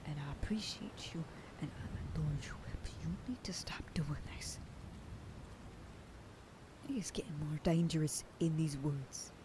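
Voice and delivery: soft worried whisper; whispered worry for a loved one; pleading whisper. Acted. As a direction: hush it close, begging them to stop. Tender plea to partner.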